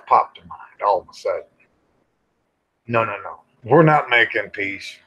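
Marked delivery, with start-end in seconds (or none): none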